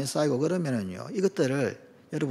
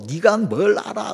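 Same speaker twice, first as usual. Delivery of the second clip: higher-pitched, drawn-out indignant retort; retold angry outburst; 6 semitones higher than usual. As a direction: imitate a friend suddenly snapping back. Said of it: "갑자기 그냥 욕이 팍 터지면서". (욱해서 대드는 말투 흉내)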